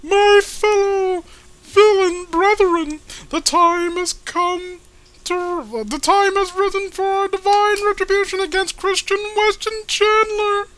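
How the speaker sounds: high grand villain proclamation to his brethren; Paul Bear voice. Acted. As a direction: orate like a pompous cartoon bad guy. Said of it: "Paul Bear voice".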